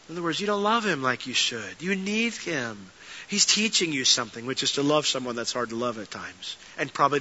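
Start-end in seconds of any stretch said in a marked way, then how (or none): none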